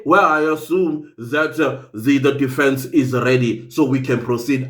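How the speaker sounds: low-pitched mock quote of a lawyer; imitating the opposing lawyer. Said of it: "he still has the aus".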